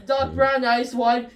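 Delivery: ordinary delivery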